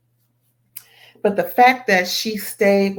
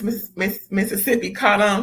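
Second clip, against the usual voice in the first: normal talking voice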